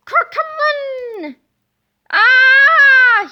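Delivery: high, loud falling scream; shriek. Acted. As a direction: scream as you fall from the sky. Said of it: "he shrieked".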